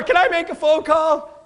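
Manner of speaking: high, loud startled panic; hammed-up alarm, looking for an escape; mock panic. Audience member realising his five people are bad, loud mock panic. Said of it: "some people going".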